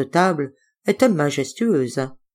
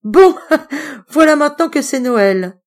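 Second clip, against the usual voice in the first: high, loud forced laughter; trying to ease the tension with a forced laugh; 10 semitones higher, 7 dB louder than usual. Acted. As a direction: laugh it off, not meaning it. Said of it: "en se forçant à rire".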